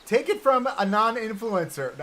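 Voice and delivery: strained, hammed-up shouting protest; mock rant. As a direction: belt it out like a mock influencer tirade. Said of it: "talking like that actually hurt my throat".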